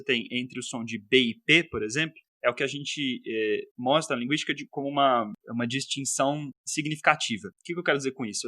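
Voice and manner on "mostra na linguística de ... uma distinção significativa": ordinary talk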